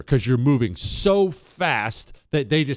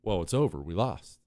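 defeated murmur; 9 semitones lower, 7 dB quieter than usual. Retold or imitated. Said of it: "would you think".

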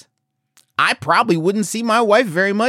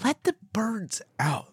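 hushed unvoiced whisper; 2 semitones higher, 12 dB quieter than usual. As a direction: breathe it like a secret. Conspiratorial aside about the birds.

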